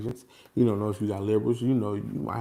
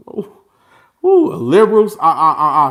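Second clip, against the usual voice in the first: shrieking high and loud through a laugh; mock horrified laugh; 6 semitones higher, 13 dB louder than usual. Real, not acted. Talking to his audience about jury fears, a laughing shriek. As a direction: laugh in mock dread at the word liberals.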